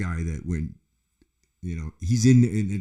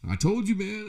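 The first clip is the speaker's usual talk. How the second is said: high-pitched, pointed quote; retold told-you-so. Another man proved right to the speaker, high mock told-you-so. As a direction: imitate him saying it, voice jumping up high. Retells another man's knowing remark.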